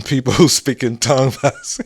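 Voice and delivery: real laughter breaking up the words; cracking up mid-sentence; trying not to laugh. Real, not acted. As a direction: barely get the story out for laughing. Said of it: "I'm trying not to laugh".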